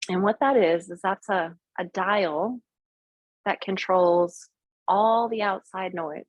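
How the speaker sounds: calm, unhurried meditation guide; guided meditation. Acted. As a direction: talk them gently into the visualization. Leads a guided visualization.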